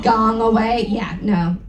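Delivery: ordinary talk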